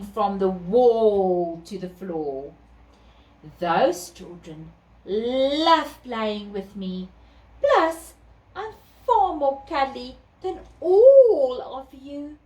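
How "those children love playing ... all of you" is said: slow, purring cat voice; a cuddly smug cat. Acted.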